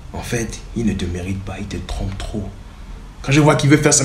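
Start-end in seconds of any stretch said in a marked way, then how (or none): 0.1-2.4 much softer, lower confiding voice; hushed scheming. Retold or imitated. Sylvain confiding to the girl, much softer and lower. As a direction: lean in and tell the secret under your breath.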